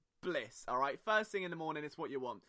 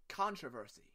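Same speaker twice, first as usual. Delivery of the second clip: American controversy; 5 semitones lower, 5 dB quieter than usual. Shown as a demonstration. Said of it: "There we go".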